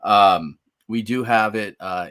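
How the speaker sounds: usual speaking voice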